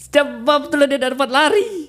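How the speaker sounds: high-pitched, squeezed through laughter; laughing while talking. Real, not acted. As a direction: struggle to finish the line while cracking up. Storyteller breaking up laughing.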